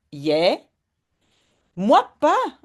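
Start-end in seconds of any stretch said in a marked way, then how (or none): 1.7-2.6 put-on higher, louder little girl's "Ik niet"; lightly voiced child saying not me; Janneke answering. Teacher reading the story, lightly voicing Janneke. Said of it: "dit Janneke".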